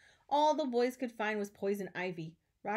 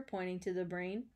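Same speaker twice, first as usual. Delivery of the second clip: ordinary delivery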